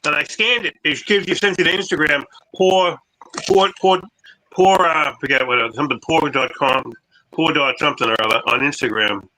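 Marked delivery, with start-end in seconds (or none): none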